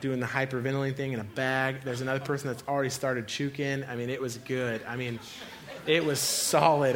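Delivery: ordinary talk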